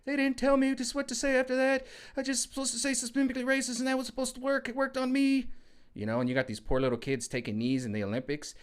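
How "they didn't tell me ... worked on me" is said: much higher whiny mock child voice; brainwashed kid. Vlogger mocks brainwashed kids being questioned, high childish whine. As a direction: squeak it out like a confused little kid. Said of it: "They're like".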